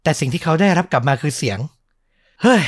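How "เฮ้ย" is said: curt, higher-pitched retort of annoyance; annoyed grunt. Retold or imitated. Passenger who won't answer the driver's greeting, annoyed. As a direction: imitate a fed-up passenger brushing someone off. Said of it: "เหมือนรำคาญ ไม่อยากจะตอบ".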